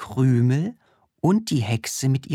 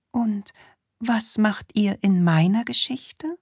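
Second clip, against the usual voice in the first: princess voice; 10 semitones higher than usual. Acted.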